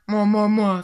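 loud moi moi moi at normal pitch; loud greedy pig; eager pig. Acted. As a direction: grunt it loudly. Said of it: "fit le cochon".

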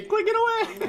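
squeaky high shout; mocking an easy win with a squeal; mock shriek. Real, not acted. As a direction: squeal it like a cartoon. Mocking a teammate's strategy.

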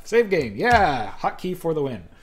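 real excited whoop; excited cheer. Real, not acted. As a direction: celebrate the save out loud. Triumphant after saving game.

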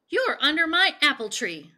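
acted indignant old lady; indignant accusation. A narrator's reading. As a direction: scold the trespasser, high and loud. Old lady confronts frog in story.

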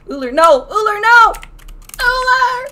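building from loud call to high wail; dismayed calling out. Real, not acted. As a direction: cry out to a doomed teammate. Crying out to endangered teammate.

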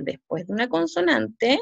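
usual speaking voice

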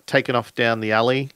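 ordinary delivery

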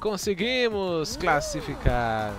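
loud, high triumphant cheer, slow; excited cheer. Real, not acted. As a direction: comemorando alto. Streamer cheers qualifying.

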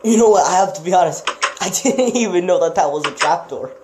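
loud and high, laughing in disbelief; excited exclaim. Real, not acted. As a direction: burst out amazed, with a laugh. Excited discovery of a trapdoor.

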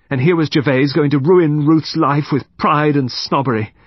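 indignant. A narrator's reading. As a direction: let the resentment burst out. Confessing woman's bitter outburst.

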